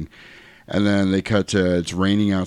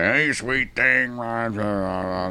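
put-on drunk yokel; 4 semitones higher, slower than usual. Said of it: "And he's like".

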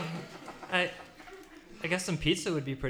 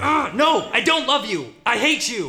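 louder, higher flat refusal; angry shouting; 7 semitones higher, 7 dB louder than usual. Acted. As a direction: shout him down. Yelling at the DJ.

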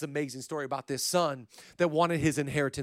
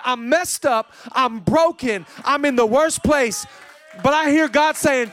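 full-force preaching; 4 semitones higher, 6 dB louder than usual. Acted. Urging the congregation to come back home, loud preaching. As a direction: preach it out, calling them home.

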